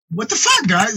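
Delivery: hammed-up exasperated cry at the friends; mock disbelief. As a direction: squeak out the retold disbelief. Retold incredulous outburst.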